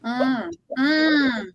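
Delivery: ordinary talk, nothing marked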